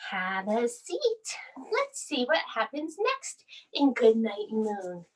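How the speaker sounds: ordinary talk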